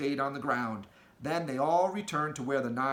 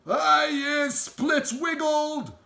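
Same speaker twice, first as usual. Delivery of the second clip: slow, much higher, loud yell; yelling giant; 13 semitones higher, 8 dB louder, much slower than usual. Acted. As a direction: yell in outrage from the ropes. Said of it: "yelled the child chewer".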